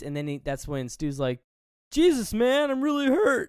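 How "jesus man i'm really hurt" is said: high, loud whining voice; hammed-up wounded whine; whiny hurt complaint. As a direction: complain about the wound like a big baby.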